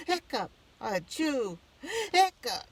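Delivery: high hiccup into a big ah-chew sneeze; hammed-up sneeze after a hiccup; hiccup then sneeze. Miss Gates tickles his nose, hiccup and sneeze. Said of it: "sneezed Mister Penny".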